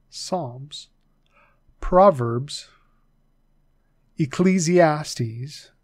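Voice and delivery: "Ecclesiastes" drawn out syllable by syllable, long E at the start; slow it right down: "Ecclesiastes"; said slowly. Shown as a demonstration. Teacher slowly sounding out "Ecclesiastes" for the class. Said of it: "I'll say those slower".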